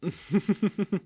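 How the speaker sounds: a short, high mocking laugh; amused laugh. Vampire hunter laughing at his chained fledgling captive, mocking laugh.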